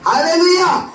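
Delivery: loud cry with laughter in it; high laughing hallelujah. Real, not acted. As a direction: shout it while still laughing. Preacher cheers after joke.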